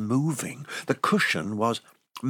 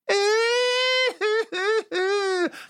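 high squeaky giggle; 3 dB louder than usual. Acted. Elf laughs in high voice.

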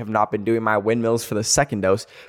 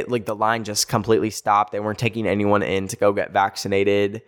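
ordinary talk

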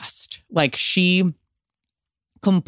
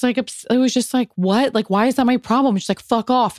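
high, irritated retelling; fed up, dismissing everyone; exasperated; 8 semitones higher than usual. Retold or imitated. Stressed bride brushing off family, high and exasperated. Family comments upsetting her.

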